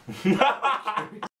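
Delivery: high loud burst of laughter; squealing laugh; high giggle. Real, not acted. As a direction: crack up laughing. Laughs at own intro.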